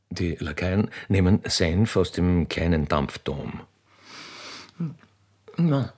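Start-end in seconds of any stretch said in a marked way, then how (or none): 0.1-3.6 stage directions read. A narrator's reading. Narrator reads out the arrival of the royal train, stage voice. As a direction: announce the scene like a stage narrator.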